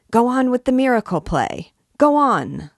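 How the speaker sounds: loud, high shout over a crowd; high, loud cry urging the actors on. A narrator's reading. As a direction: yell it out to rouse the crowd. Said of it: "he began to shout".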